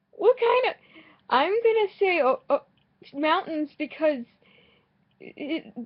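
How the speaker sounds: high, loud half-panicked squeal; squealing at the thought of sharks; panicked about sharks. Real, not acted. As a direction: blurt it out in a rising panic.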